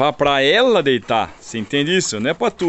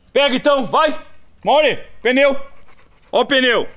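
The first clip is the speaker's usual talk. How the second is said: high, loud excited call; calling out to dogs; 12 semitones higher, 5 dB louder than usual. Real, not acted. Owner calls his dogs to grab the tyre, loud and high. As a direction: rile the dogs up to play.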